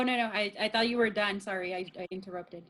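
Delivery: ordinary talk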